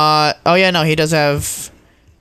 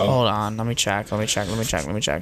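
soft muttering, fading lower and quieter; muttering to self; 6 semitones lower, 7 dB quieter than usual. Real, not acted. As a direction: mutter it under your breath while checking. Checking app, muttering to self.

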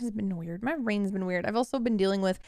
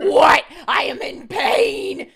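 much higher and louder, stretched out; retold outraged cry; 10 semitones higher, 9 dB louder, much slower than usual. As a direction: replay the shocked protest at full volume. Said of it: "And I was like".

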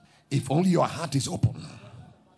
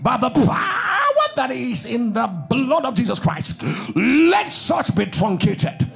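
loud, high, chanted shouts of blessing and tongues; full-force preaching; 7 semitones higher, 4 dB louder than usual. Acted. Preacher calling the congregation to break free, shouting and chanting. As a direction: pour it out over the crowd, ringing and urgent.

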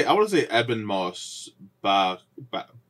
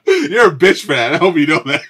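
higher and louder, laughing through it; laughing jab; 5 semitones higher, 6 dB louder than usual. Real, not acted. Laughing at his cohost for getting emotional. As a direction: scold him playfully between laughs.

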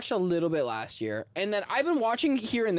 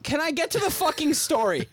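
raised, high-pitched exasperated demand; 10 semitones higher, 4 dB louder than usual. Real, not acted. Interrupted storyteller to co-host, exasperated. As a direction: cut in, fed up with interruptions.

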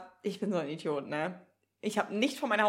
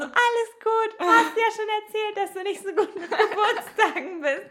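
mock chirpy friend; 11 semitones higher, 4 dB louder than usual. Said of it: "Und sie war so".

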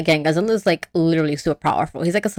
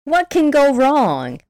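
higher, drawn-out mock optimism; breezy doubt; 7 semitones higher, slower than usual. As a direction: stretch it out, playfully unsure. Retold naive optimism about the plan.

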